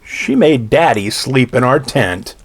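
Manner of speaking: plain reading voice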